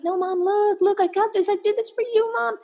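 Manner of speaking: put-on very high, pleading kid voice; insistent child. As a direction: beg mom to look, like a small child. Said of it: "You might have been a child".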